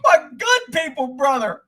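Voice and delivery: high, loud, slurry weeping; drunk crying. Pretend. Host mocks himself as a crying drunk to viewers, high loud blubbering. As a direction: sob out brotherly love like a sloppy drunk. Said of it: "Somebody's already drunk and crying".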